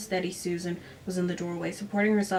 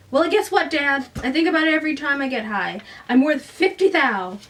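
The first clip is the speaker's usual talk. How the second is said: louder, higher, bitter lash at Dad; bitter outburst; 6 semitones higher, 6 dB louder than usual. Acted. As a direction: spit it back at her father. Susan lashes out at Dad.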